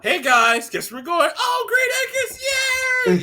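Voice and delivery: high, gleeful cheer for Green Acres; family cheering, going to Green Acres; mock family excitement. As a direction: pretend kid thrilled about the outing. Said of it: "the family treat right there".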